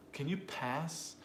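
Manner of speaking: "pass" stretched out slowly; slow question leaning on "pass"; stress on pass. Shown as a demonstration. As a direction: linger on the word "pass". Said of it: "It's a content word".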